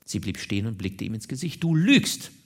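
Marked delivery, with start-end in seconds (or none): 1.6-2.3 loud, high-pitched accusation; sharp, raised rebuke; accusing. A narrator's reading. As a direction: hurl the accusation in his face. Woman accuses man of lying.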